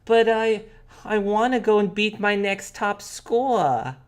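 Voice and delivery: put-on high-pitched child objecting; protesting little sister. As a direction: plead with mom like a child.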